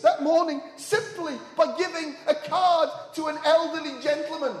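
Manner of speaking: high, loud preacher's voice; rousing, forceful sermon climax; impassioned preaching. Acted. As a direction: drive each phrase home to the congregation.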